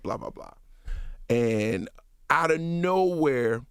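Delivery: usual speaking voice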